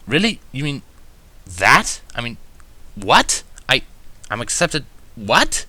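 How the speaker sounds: rising, breathless questions in shock; can't believe what he just heard; stammering amazed questions; stunned disbelief. A narrator's reading. Narrator stunned at acceptance.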